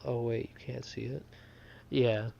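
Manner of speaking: ordinary talk, nothing marked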